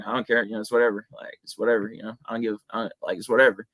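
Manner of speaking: lightly voiced laid-back fighter, unbothered; Nick Diaz impression. Shown as a demonstration. YouTuber demoing his fighter impression for the interviewer, lightly voiced and unbothered. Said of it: "Nick Diaz is one that I do a lot".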